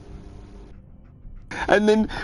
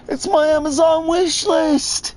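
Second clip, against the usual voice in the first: mock protest; 13 semitones higher than usual. Voicing the man protesting.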